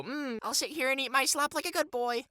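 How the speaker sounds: high-pitched mock good boy; sarcastic high simpering imitation; mocking obedient voice. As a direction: put on a high, compliant little voice to mock them. Mimicking an obedient slop-eater.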